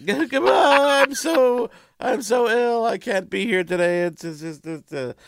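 high whiny sick voice; hammed-up poorly patient, high and loud; fake sick voice. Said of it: "Putting on his sick voice there".

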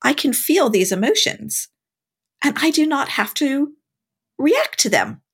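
high, wondering voice full of discovery; amazed realization. Retold or imitated. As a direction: voice lifting in awe as it dawns. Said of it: "I was like".

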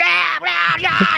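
high loud mock morning DJ squeal; high cartoonish DJ whoop; zany DJ yell. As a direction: squeal it like a manic radio host. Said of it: "they're just like".

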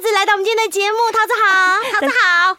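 loud, high TV host welcome; excited host. Acted. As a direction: greet the star guest brightly. (兴奋的主持人)

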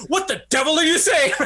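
mock indignant cry, pitched high; Roger Moore upset. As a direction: imitate a man getting really upset. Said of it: "Getting really upset".

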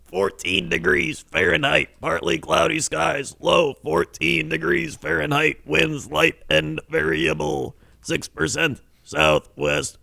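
measured announcer forecast read; weather forecast read. Acted.

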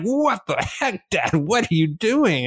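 dad imitating his kids' horrified reaction; mock kids. Kids react to dad's accent.

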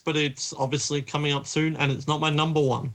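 ordinary talk

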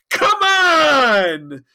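high, loud shouted protest; exasperated shout. Acted. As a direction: yell it in disbelief, arms wide. Tom protesting to Janeway.

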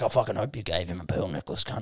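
low, quiet dad impression; imitating his dad. Imagining dad's reply to his mate's father, low dad voice. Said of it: "My dad would be like".

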